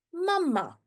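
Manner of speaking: "mama" as two short syllables; demonstrated pronunciation. Shown as a demonstration. Teacher shows how double-M "mama" is said, short syllables. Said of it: "when we say it, we say".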